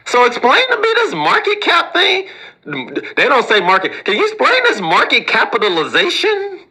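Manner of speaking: high, eager voice faking a simple question; pretend smart person. Harvard grad asking the host to explain market cap, mock eager. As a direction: play a know-it-all who hides not knowing. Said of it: "this is how people that are intelligent, but they don't wanna say they don't know it".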